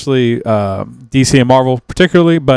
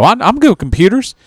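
lightly voiced eager hillbilly, pitched up; higher-pitched mock country boast; 9 semitones higher than usual. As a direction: imitate a hillbilly volunteering, voice lifted high. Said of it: "everybody's country and hillbilly as hell".

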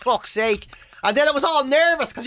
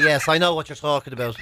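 usual speaking voice

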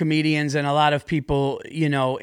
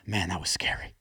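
low hushed quote; 9 semitones lower, 9 dB quieter than usual. Retold or imitated. Teixeira quoted after landing.

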